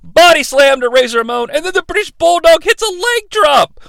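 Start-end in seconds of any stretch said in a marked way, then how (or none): none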